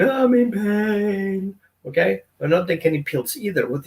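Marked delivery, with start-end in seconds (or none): 0.0-1.6 slow, drawn-out mock whining; mock whine. Dog that would say it hurts, mock slow whine. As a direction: whine it like a dog complaining of pain. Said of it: "dogs don't yell and whine".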